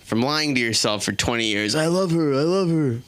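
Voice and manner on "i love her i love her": repeated with a different, strained voice; self-convincing husband. Acted. Husband repeats love for wife to convince himself, strained. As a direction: talk yourself into it.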